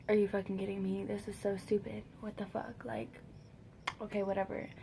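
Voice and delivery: quiet, fed-up grumble to oneself; resigned disbelief. Retold or imitated. Acts own silent disgust on couch.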